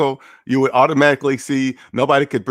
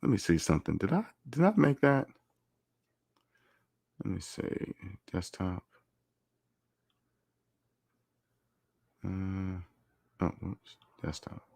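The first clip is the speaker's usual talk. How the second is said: very soft, low mumble; barely audible, absent-minded muttering; muttering to self; 5 semitones lower, 13 dB quieter than usual. Real, not acted. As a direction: talk under your breath while searching. Muttering while hunting for a file.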